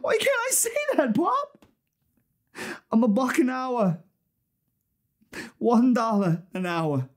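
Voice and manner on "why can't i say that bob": very high, desperate pleading cry; anguished outburst. Acted. Office worker asking why he is here, anguished cry. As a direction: let the despair pour out at full pitch.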